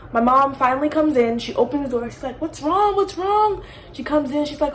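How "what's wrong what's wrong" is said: high-pitched worried questions, acted; alarmed mother. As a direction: imitate a mom rushing in worried. Mom rushes in to screaming child.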